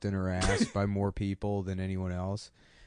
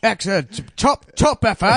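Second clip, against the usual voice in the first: much higher, louder hammed-up British praise, slow at the end; mock British official; 11 semitones higher, 9 dB louder, slower than usual. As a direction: gush like a cheery British record judge. Guinness officials approving the record.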